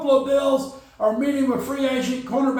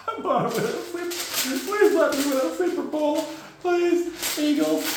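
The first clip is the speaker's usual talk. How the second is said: begging whine; 5 semitones higher than usual. Retold or imitated. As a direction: plead with the Eagles. Player begging to win Super Bowl.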